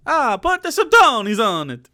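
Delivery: loud mock Italian accent. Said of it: "with an Italian accent".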